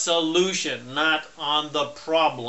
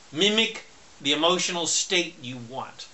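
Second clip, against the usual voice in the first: normal talking voice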